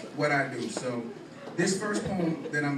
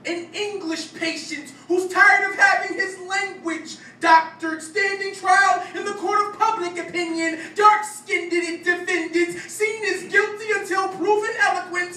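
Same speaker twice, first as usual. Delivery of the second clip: higher, louder, rhythmic slam-poem delivery; spoken-word poetry; 7 dB louder than usual. Acted. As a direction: perform it as a punchy stage poem.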